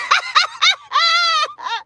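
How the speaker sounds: shrill-pitched cackle after the punchline; high cackling laugh. Real, not acted. Laughing at her own joke.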